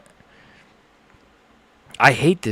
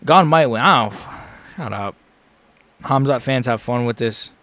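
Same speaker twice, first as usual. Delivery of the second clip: ordinary delivery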